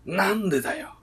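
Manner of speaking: high, loud straight-man comeback; playful comedian's snap; tsukkomi retort. Pretend. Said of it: "大竹かよ".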